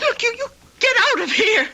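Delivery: high, panicky get-out; frightened order. Acted. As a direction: push him away in alarm. Frightened girl orders him out.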